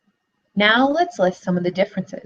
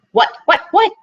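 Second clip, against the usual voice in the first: sharp raised shout, a little louder; shouting fox; 11 semitones higher, 5 dB louder than usual. A narrator's reading. Teacher reading the fox interrupting the piglet, a shout. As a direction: snap it out impatiently as the fox. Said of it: "shouted the fox".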